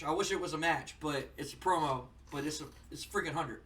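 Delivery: ordinary delivery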